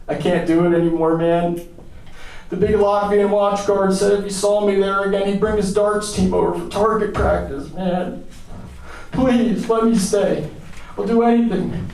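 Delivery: high, distressed begging; worked-up, high-pitched appeal; desperate pleading. Acted. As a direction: plead as if out of options. American begs to be let stay.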